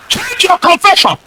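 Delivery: high-pitched preacher's command; rousing exhortation. Real, not acted. Preacher telling the congregation to change confession, high rousing call.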